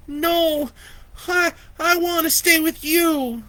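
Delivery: put-on high, stammering child's protest; a scared girl refuses to leave. Lori protests to Vicky, high and frightened. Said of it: "Lori protested".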